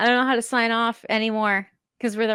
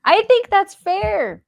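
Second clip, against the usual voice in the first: mock judge voice; 11 semitones higher, 6 dB louder, slower than usual.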